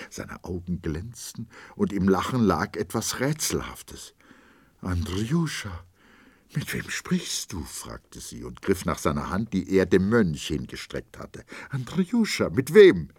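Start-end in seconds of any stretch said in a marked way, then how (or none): 4.8-7.6 wife, waking, asks her husband uneasily who he talks to; hesitant fearful question. A narrator's reading. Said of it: "voll Verwunderung und Furcht". 11.7-13.1 much higher and louder, pressing hard on wem; wife insists, frightened, on who her husband speaks to; alarmed urgent question. A narrator's reading. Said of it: "voll Verwunderung und Furcht".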